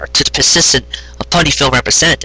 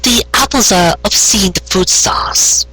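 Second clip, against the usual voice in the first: higher, slow, obvious tone; Carrot Top voice; 8 semitones higher, slower than usual. Acted. Carrot Top explaining to Trixie, matter-of-fact. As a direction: say it like everyone knows.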